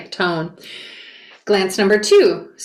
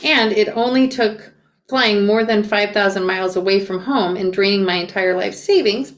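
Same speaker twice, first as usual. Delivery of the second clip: usual speaking voice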